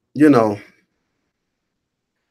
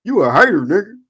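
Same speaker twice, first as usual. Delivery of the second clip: mocking quote; 8 semitones higher than usual.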